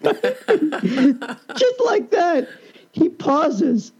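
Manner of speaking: high, slow laughing words. Real, not acted. Laughing as he tells the grandfather's turn. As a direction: break up laughing on it.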